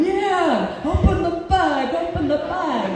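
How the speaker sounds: put-on high-pitched crowd chant in New York accent. Circle of workers urging her to open the bag, New York accent. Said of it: "they spoke with New York accent".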